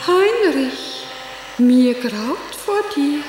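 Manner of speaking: high ringing cry, then slow dread; theatrical stage declamation. Acted. Actress quoting her line from the Berlin stage, declaimed. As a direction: declaim it grandly, drawing out each word. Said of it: "so wie ich auf der Bühne sagte".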